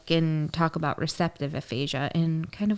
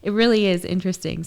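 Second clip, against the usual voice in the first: normal talking voice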